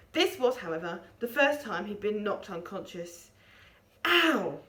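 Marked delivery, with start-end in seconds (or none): 4.0-4.6 mild groan. A narrator's reading. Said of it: "he groaned".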